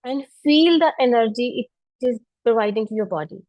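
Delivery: calm, unhurried meditation voice; guided meditation. Acted. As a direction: guide them gently through each breath. Leads seated pranayama. (ध्यान कराने वाली शांत आवाज़)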